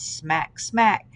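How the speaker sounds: ordinary delivery, nothing marked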